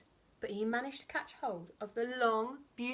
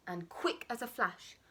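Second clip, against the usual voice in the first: usual speaking voice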